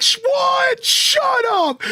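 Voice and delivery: very high, shouted disbelief, imitated; hammed-up chat yelling in shock; mock shocked shout. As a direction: squeal it out like a stunned viewer. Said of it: "The chat is like".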